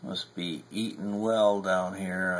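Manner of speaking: ordinary talk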